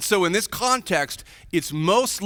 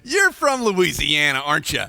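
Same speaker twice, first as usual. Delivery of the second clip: laughing, louder playful accusation; teasing laugh; 4 semitones higher, 3 dB louder than usual. Retold or imitated. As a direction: tease them about the accent, laughing. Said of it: "from the deep South or something".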